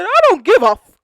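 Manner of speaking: high, very loud defiant yell. Retold or imitated. Jonathan to the security guard outside the van, yelling. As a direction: yell it and cut off the swear. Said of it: "he's yelling".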